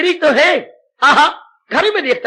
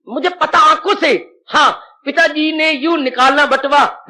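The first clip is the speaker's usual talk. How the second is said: usual speaking voice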